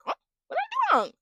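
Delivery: extremely high, clueless squeak; mock confusion. Contestant playing innocent when confronted, mock confused. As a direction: imitate her baffled, in an exaggerated tiny high voice. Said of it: "she's confused".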